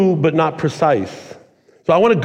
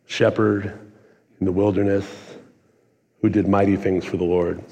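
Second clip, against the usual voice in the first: quiet echo; 8 semitones lower, 6 dB quieter than usual. Real, not acted. Echoing David parallel.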